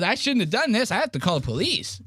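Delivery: high, whiny mock plea; nervous nerd friend. Mocking the nerd friend begging to leave, high and whiny. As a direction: whimper like the friend bailing on the trip. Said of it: "He goes".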